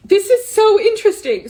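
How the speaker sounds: pushing words out through tears; real crying while speaking. Real, not acted. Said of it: "I'm feeling emotions about thinking back".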